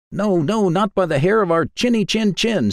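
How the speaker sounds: very high, squeaky pigs refusing; high squeaky piglets standing firm; little pigs' voice. A narrator's reading. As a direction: pitch it way up like tiny pigs.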